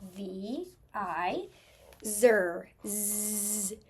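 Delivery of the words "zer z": ZER, then a long buzzing Z; sounding out. Shown as a demonstration. Teacher stretching the last syllable to spell, buzzed Z. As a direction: isolate the first sound of ZER as a held Z. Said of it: "S can make two sounds".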